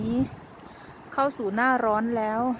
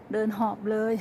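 out of breath. Real, not acted.

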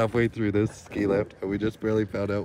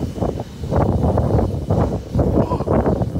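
big, high gasp of awe; loud gasp; 8 dB louder than usual. Real, not acted. Seeing the moon from the lift, a loud awed gasp. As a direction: gasp at the sight. Gasping at the view.

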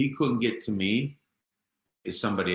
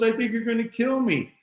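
high, louder, imitating a frightened wife; retold fear; 5 dB louder than usual. Frightened wife confesses her fear to her husband, retold fear. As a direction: blurt it high, as the scared wife. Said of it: "I'm scared".

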